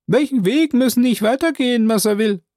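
high, loud servant voice calling down; Jupiter's voice. Acted. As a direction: pitch him well up and call out.

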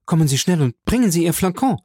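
high, urgent plea; calling out. A narrator's reading. Valentine begs her stepmother to hurry, calling urgently. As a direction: call urgently for help, pitched high. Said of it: "rief Valentine".

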